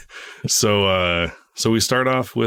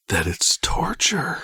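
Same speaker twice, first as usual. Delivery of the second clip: slow scary whisper; spooky whisper; 5 semitones higher, slower than usual. Acted. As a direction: hiss it like a haunting. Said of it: "Now you're getting it".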